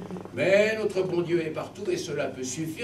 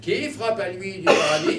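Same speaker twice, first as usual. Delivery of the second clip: louder cry of the gatekeeper; Saint Peter calling out; 4 semitones higher, 4 dB louder than usual. Acted. Saint Peter calling to the soul at the gate, loud cry. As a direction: call out from heaven's gate. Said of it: "s'écria Saint-Pierre".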